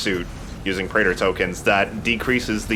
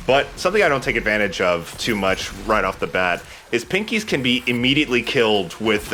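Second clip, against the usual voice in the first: ordinary delivery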